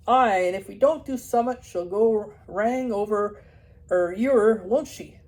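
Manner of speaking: loud broad Yorkshire farmer. Acted. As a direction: keep the dalesman's broad burr. Farmer continues.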